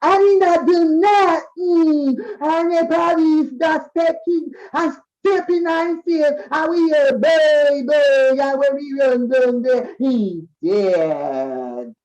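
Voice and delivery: high, loud, slow cry of protest; distressed retelling. Retold or imitated. Witness telling the police scene to TV, loud and distressed. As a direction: act out the frantic witness, loud and drawn out. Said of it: "they say".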